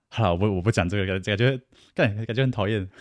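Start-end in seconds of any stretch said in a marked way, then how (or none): none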